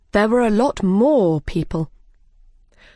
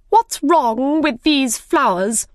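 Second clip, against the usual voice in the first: suspicious queen; 7 semitones higher, 3 dB louder, slower than usual. Acted. The Queen inspects the flowers.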